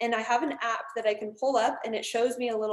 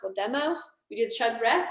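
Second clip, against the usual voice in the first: ordinary talk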